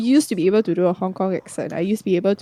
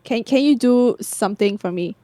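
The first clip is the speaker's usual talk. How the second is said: ordinary delivery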